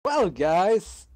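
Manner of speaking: loud, high call to the group; loud rising well. Real, not acted. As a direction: grab the party's attention. Player calling out to party.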